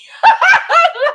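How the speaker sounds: loud high cackle; burst of laughter. Real, not acted. Cracking up after retelling cussing him out. Laughing at own retold insult.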